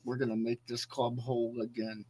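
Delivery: low, soft, jaw-clenched fake American accent; mock Charlie Hunnam doing an American accent through a clenched jaw; tight-jawed imitation of a bad American accent. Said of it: "clench the jaw and talk like this".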